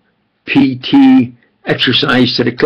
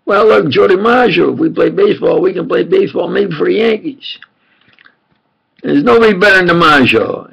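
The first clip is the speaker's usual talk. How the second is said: put-on kids bragging; 7 semitones higher, faster than usual. Kids bragging about DiMaggio.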